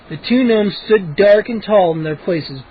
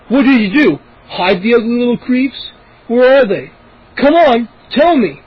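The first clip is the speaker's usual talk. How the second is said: demanding; 5 semitones higher, 4 dB louder than usual. A narrator's reading. Moose pressing, demanding.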